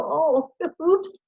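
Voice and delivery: high, sheepish laughing realization; laughing oops. Retold or imitated. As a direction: laugh at your own silly mistake. Laughing at own retold mistake.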